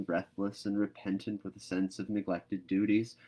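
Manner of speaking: usual speaking voice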